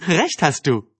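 amused, just after a laugh; laughing agreement. A narrator's reading. Anne gives in to Dick's teasing, laughing. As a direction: agree through the end of a laugh. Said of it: "warf lachend den Kopf".

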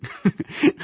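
high, breathy burst of laughter; short laugh. A narrator's reading. Anne boxes Dick in the ribs, laughing. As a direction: laugh briefly in amusement. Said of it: "warf lachend den Kopf in den Nacken".

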